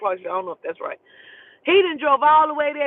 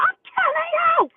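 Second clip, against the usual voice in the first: very high-pitched screamed threat; screaming; 3 dB louder than usual. Retold or imitated. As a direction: scream it so it carries down the street. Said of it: "I'm screaming".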